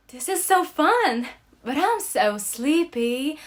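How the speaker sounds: excited burst that fades to drowsy; Alaska's voice remembered. Retold or imitated. Remembering Alaska on the swing, excited then sleepy.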